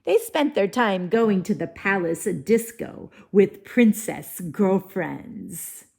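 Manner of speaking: ordinary talk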